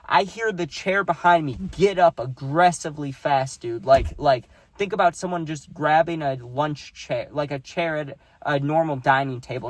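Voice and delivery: ordinary delivery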